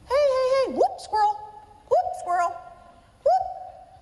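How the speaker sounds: high, yelping dog from Up spotting a squirrel; distracted dog. Imitating the dog from Up to tease distracted NPs, high yelping squirrel call. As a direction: snap to attention mid-sentence and yelp. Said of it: "Remember that movie Up?".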